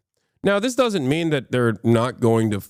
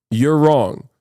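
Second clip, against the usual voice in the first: ordinary talk, nothing marked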